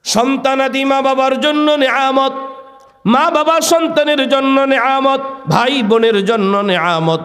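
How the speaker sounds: high sermon chant on a held note; preacher's intoned high call; impassioned preaching. Acted. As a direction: proclaim the lesson high and ringing.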